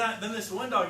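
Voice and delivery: ordinary talk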